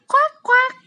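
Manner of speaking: high loud quacking; duck quack. Pretend. Teacher imitating duck.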